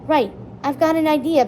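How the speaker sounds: decide it with a lift of resolve; determined. A narrator's reading. Barry rallying his friends, mildly determined. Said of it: "Barry said in a determined voice".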